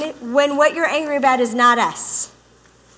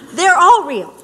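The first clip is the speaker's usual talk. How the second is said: laughing insistence; 4 semitones higher, 6 dB louder than usual. Real, not acted. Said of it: "men will wonder which one was real".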